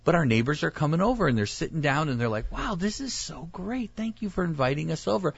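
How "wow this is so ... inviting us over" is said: grateful guest. Acted. Neighbors thanking the host for inviting them, grateful. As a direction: marvel softly in a higher voice, then thank the host.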